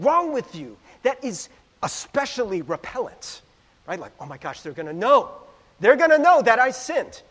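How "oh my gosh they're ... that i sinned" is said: hammed-up worry, building louder and higher; rising dread of being found out; mock panic. Voicing a sinner in church, mock panicked. Voices a churchgoer fearing exposure.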